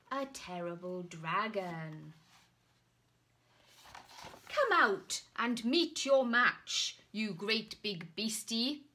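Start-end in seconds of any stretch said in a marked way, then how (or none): 4.5-8.8 loud, bold challenge; knight calling out. Acted. Knight challenging the dragon, loud bold call. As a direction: bellow at the hidden beast. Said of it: "called the knight".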